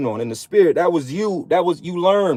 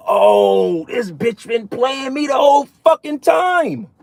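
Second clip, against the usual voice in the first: high, drawn-out realisation wail; dawning outrage; 9 semitones higher, slower than usual. Acted. Acting out a man realising he's been played, outraged wail. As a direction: cry it out high and slow, acting out the shock. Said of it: "You be like".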